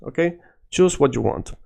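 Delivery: usual speaking voice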